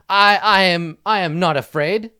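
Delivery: loud, high stammered defiance; faltering bravado. Acted. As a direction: play a kid insisting on courage while backing away. Kid backing away, claims bravery.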